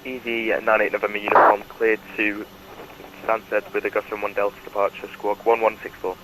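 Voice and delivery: over a pilot headset. Real, not acted. Pilot readback over radio.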